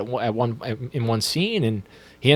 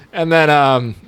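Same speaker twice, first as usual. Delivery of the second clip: ordinary talk, nothing marked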